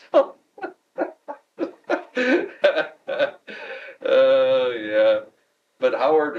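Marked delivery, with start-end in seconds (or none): 1.6-4.0 a long, high peal of laughter; real high laugh. Real, not acted. Retiree amused by the boss pumping him for Detroit news, laughing. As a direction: let the laugh ring out and fall.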